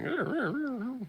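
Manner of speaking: high squeaky animal noises; rabbit squeaks. Retold or imitated. The shaking rabbit squeaking in fear. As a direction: squeak like a frightened bunny. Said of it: "It's like".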